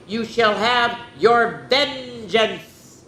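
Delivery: slow, drawn-out threat; slow ominous vow. Acted. Madeline warns the lord, slow and ominous. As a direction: promise revenge slowly.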